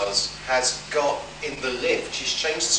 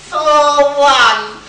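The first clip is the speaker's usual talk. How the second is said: much higher, louder mock toddler voice; imitating a small child; 13 semitones higher, 14 dB louder than usual. Toddler announcing floors in the lift, mock child voice. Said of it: "disembodied coming through a grill".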